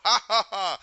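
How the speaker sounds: loud, high staged "ha ha ha"; mock triumphant laugh. Gamora laughs at Thanos, loud mocking laugh. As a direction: imitate her laughing in his face. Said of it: "Gamora starts laughing".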